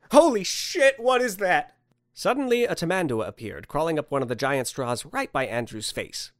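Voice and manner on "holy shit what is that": high, loud outburst; startled. Acted. Man spots an anteater by his face, startled outburst. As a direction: yelp it out in alarm.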